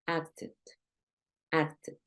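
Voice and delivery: "acted" spoken softly with its ED sounded; ED as full syllable. Shown as a demonstration. Teacher echoes the example for learners, soft "acted" with sounded ED. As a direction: softly give "acted" two syllables, ending "ed". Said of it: "lo vas a pronunciar como una E D".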